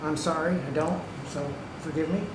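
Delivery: ordinary delivery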